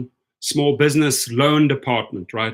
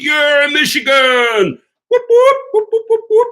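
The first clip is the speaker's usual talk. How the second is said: high, loud whooping cheer; 12 semitones higher, 6 dB louder than usual. Real, not acted. Streamer cheering Michigan's good news with loud whoops. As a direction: whoop it up like a fan in the stands.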